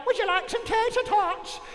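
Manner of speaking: high, cheery put-on voice of the lunch lady; cafeteria lady voice. Lunch lady offering tater tots, high cheery voice. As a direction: play the eager lunch lady offering food. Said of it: "she'd be like".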